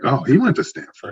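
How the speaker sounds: high, bright-eyed "oh"; youthful excitement, retold; excited recall. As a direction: recall the thrill of realizing it.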